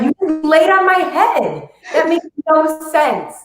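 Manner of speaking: slow, high mock exasperation; exasperated. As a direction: spell it out slowly to a stubborn cat. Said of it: "And I'm like".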